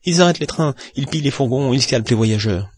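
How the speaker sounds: high, loud list of dangers; heated protest. A narrator's reading. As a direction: pile up objections with rising indignation. Stuart heatedly lists possible dangers. (protestation enflammée)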